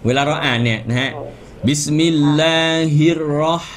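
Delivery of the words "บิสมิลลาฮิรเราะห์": recited "บิสมิลลาฮิรเราะห์" with lips unpursed on "เราะห์"; unrounded R. Shown as a demonstration. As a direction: draw out "บิสมิลลาฮิรเราะห์", rolling an unrounded r. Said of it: "ดูปากมันจะไม่ห่อ".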